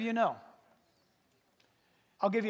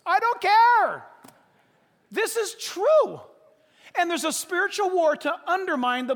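emphatic declaration; 7 semitones higher, 7 dB louder than usual. Real, not acted. Pastor defying critics from pulpit.

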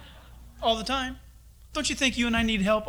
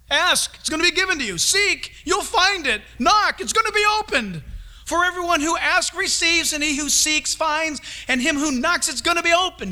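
higher, louder, pressing invitation; raised excited preaching voice; urgent pleading; 7 semitones higher, 4 dB louder than usual. Acted. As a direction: urge them on, almost begging. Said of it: "Jesus is speaking".

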